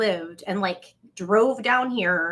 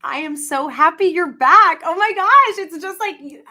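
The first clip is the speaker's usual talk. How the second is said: gushing; 8 semitones higher, 7 dB louder than usual. Acted. Gushing at viewer.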